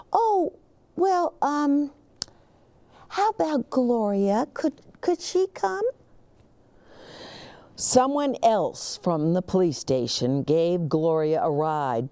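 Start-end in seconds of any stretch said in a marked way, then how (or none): 0.1-5.8 higher, hesitant hopeful asking; hesitant principal. Acted. The principal asks Officer Buckle for Gloria, hesitant and higher. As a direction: ask haltingly for the dog.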